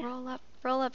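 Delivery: ordinary talk, nothing marked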